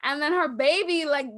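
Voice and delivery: usual speaking voice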